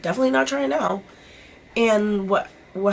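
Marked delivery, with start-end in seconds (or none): 0.0-1.0 joking dismissal. Retold or imitated. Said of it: "I was like".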